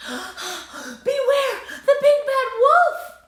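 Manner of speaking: high, slow frightened cry; panicked cry. A narrator's reading. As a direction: warn them in terror. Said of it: "cried the first little pig".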